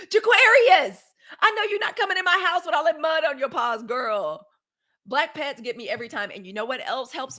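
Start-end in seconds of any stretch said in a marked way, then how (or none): 0.1-4.5 high, loud cry at a muddy dog; scolding pet owner. Retold or imitated. Pet owner catching her muddy dog, high squealing scold. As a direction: squeal the dog's name in horror, then scold.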